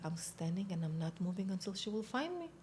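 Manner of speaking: quiet, straight-faced insistence; deadpan serious. Retold or imitated. Recruit explains herself to the psychiatrist, quietly deadpan. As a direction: say it softly, as if in earnest. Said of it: "I need to be serious".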